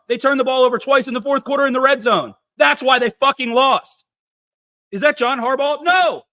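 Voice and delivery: loud, high-pitched angry outburst; fed-up rant. Real, not acted. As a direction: rant at full heat, punching it out. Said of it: "So I'm sick and tired of it".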